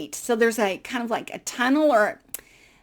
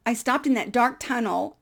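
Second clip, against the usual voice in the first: ordinary talk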